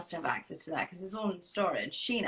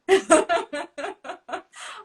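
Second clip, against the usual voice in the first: bright burst of laughter; real laugh; 8 semitones higher, 9 dB louder than usual. Real, not acted. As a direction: laugh out loud, high. Laughs at nervous story.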